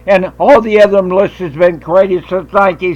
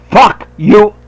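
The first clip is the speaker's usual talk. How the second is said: retold curse; 2 semitones higher, 4 dB louder than usual. Retold confrontation repeated.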